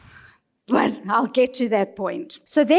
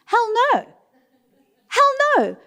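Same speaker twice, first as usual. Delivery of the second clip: emphatic hell no; 11 semitones higher than usual. Real, not acted. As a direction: flatly reject the idea of facing a rhino. Emphatic refusal.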